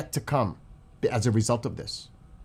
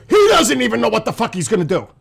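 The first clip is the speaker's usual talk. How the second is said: much higher and louder, fast furious rant; real anger; 10 semitones higher, 6 dB louder, faster than usual. Real, not acted. Commentator rages at an inconsistent president, fast furious shouting. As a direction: let it rip at the president, exasperated. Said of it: "I am pissed".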